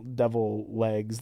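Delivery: ordinary talk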